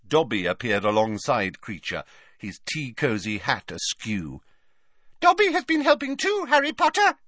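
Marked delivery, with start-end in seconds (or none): 5.2-7.2 very high, eager squeak; squeaky house elf. A narrator's reading. Elf butting in to claim credit, in a high squeak. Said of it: "he squeaked".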